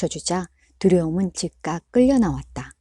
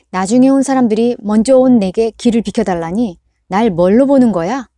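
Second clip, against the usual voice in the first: indignant; 3 semitones higher, 8 dB louder than usual. A narrator's reading. Driver's inner wounded pride.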